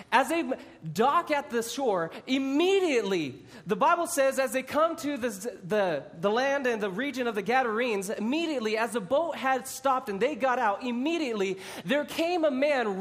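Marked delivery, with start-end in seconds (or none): none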